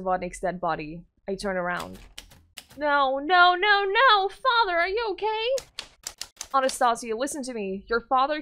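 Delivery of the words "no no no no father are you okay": loud, higher desperate no no; distraught cry. Acted. Girl finding her father shot, distraught cry. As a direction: break into horror over him.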